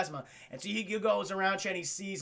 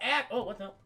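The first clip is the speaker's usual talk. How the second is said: normal talking voice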